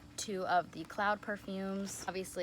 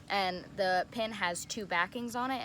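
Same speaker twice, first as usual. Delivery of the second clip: ordinary talk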